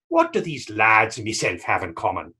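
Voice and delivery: ordinary talk, nothing marked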